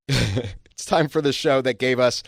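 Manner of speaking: usual speaking voice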